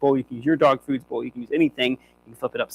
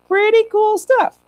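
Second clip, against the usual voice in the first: high, slow squeal of delight; excited squeal; 7 dB louder, slower than usual. Real, not acted. Trainer delighted after the dog's run, squealing. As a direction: gush with delight.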